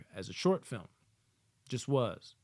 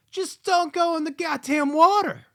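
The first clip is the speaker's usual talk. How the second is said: high, loud yell at the screen; exasperated yell; 11 dB louder than usual. Acted. Viewer yelling at swimmers in a shark movie, exasperated. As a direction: yell it like a fed-up horror viewer.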